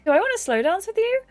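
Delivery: high, quick rising question; acted giddy flirtation; delighted answer. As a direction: toss the question back in a high, eager voice. Delighted answer to dance invitation.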